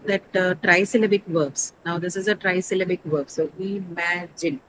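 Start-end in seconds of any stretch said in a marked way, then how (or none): 3.6-4.6 "imagine" drawn out slowly in three syllables. Shown as a demonstration. Teacher counts the syllables for students, slow "imagine" in parts. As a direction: say "imagine" slowly, i-ma-gine, each part separate. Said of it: "has three syllables".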